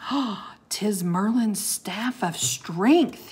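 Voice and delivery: awed, slow whisper; gasping whisper. Acted. Teddy sees Merlin's staff and whispers in awe. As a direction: breathe it out in wonder. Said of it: "Teddy gasped".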